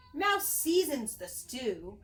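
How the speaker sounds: ordinary delivery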